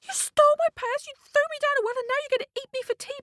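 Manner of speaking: very high, indignant squawk; disbelieving cockerel. Acted. Cockerel to the cook about to roast him, outraged disbelief. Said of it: "the cockerel couldn't believe it".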